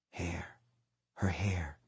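very soft, low, hushed; halting, barely voiced as the thought forms; dawning realization. A narrator's reading. Dreamer slowly noticing the girl's hair, in a hush.

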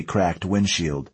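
plain reading voice

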